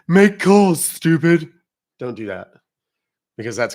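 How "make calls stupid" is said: loud, high mocking demand; mock rude chatter. Mocking a rude chatter barking orders at the host. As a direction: sneer it like a rude viewer. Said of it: "don't come and say".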